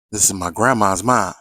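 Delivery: normal talking voice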